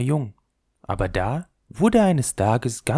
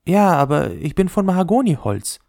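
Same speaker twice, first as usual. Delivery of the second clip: higher, eager suitor voice; the top courting the ball, showing off; a toy boasting to his sweetheart, higher; proud spinning top; 8 semitones higher, 5 dB louder than usual. Acted.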